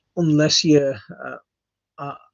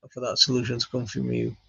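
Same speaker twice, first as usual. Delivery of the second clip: ordinary talk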